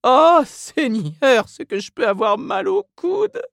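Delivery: high, drawn-out woman's groan; moaning Mrs Reilly. A narrator's reading. Mrs Reilly complains of her sore elbow, moaning. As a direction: moan the first words slowly, pitched high.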